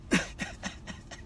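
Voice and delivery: soft, voiceless snickering laugh; amused chuckle. Real, not acted. Joking to a rival about prison, quiet snicker. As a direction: chuckle quietly at your own joke.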